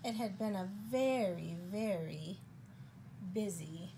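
soft, measured bedtime-story ending; picture-book narration. A narrator's reading. Reader closing the spider's busy day, storybook narration. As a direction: wind the story down with pauses.

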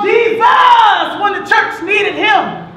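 high, loud, drawn-out cry; preacher's yell. Acted. Poet voicing papa crying out for the church, full yell. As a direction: peak on the name, then ease a little. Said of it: "He yelled".

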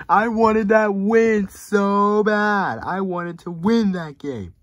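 high, loud, slow wail of disappointment; anguished wail. Real, not acted. Fan mourns a lost game, anguished drawn-out wail. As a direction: drag it out like a tantrum.

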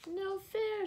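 high, loud protest; shouted. A narrator's reading. Monkeys protesting to Lulu, shouting high. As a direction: yell it like caught kids. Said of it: "shouted the monkeys".

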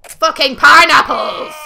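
furious gamer shout; angry shout. Real, not acted. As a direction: bellow it in frustration. Frustrated outburst at game.

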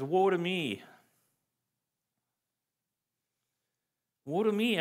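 high anguished cry of woe. Acted. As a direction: cry out in despair before God. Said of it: "I cried".

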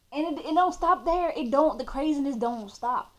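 rising excitement; excited. Real, not acted. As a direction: burst back in, fired up. Excited storytelling.

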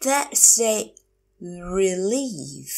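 That's a, long pause, drawn-out "relief"; slow teacher model of "That's a relief"; slow, broken up. Shown as a demonstration. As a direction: stretch "relief" after a clear gap. Teacher repeats phrase slowly.

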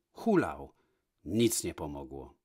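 ordinary delivery

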